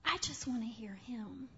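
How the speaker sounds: very soft and low, barely there; hushed longing. Real, not acted. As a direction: drop it to a near whisper of longing. Longing to hear God.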